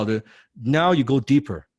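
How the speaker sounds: ordinary delivery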